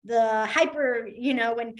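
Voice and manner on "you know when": usual speaking voice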